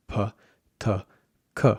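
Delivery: p, t, k each with a burst of air; breathy release on p, t, k; aspirated English p, t, k. Shown as a demonstration. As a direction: puff air out after p, t, k. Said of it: "in English, these sounds sound like".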